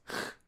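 lone throaty scraped ch; back ch. Shown as a demonstration. German teacher on A, O, U with C H, back ch. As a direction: sound out ch at the back. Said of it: "That's pronounced".

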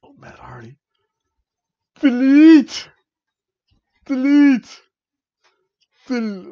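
high, loud shrieked "Delete"; mock Matt Hardy. Fan reacting, imitating Matt Hardy's shrieked delete. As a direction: screech it like the broken one. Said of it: "And I'm like".

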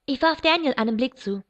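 plain reading voice

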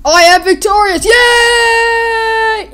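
high, loud victory shout; hammed-up gloating winner; mock triumph. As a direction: proclaim it, ending in a long squealed cheer. Voices winner cheering.

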